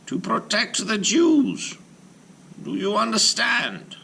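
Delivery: high, slow solemn rabbi's voice. Acted. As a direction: lay out the purpose with gravity. Rabbi instructs golem.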